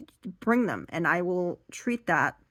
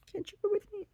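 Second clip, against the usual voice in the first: small begging voice; 5 dB quieter, much faster than usual. Retold or imitated.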